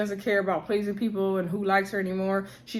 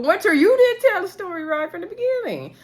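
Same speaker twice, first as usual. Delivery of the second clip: higher and louder, calling Winter out directly; indignant callout; 10 semitones higher, 4 dB louder than usual. Real, not acted. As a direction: raise it, talk straight at her. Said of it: "speaker addresses Winter directly".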